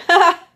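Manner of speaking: one loud, high burst of a laugh; sharp bitter laugh. Retold or imitated. Patient hearing bad news, a loud bitter "Ha!". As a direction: bark out a single disbelieving laugh.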